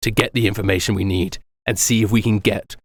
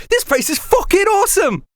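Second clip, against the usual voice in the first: high, gleeful whoop; thrilled Doxy. Acted. Doxy jumping onto the dock, high and gleeful. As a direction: burst out with delight at the new island. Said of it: "and goes".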